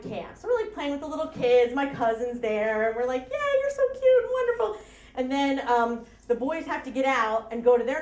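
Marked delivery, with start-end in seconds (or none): 3.3-4.8 high-pitched squeal of delight; mock gushing in a much higher voice; gushing cheer. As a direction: coo excitedly over little kids, high. Voicing campers gushing over little kids.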